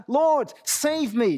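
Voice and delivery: slow mild cry for help; understated desperate cry to the Lord. Retold or imitated. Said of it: "He cried out".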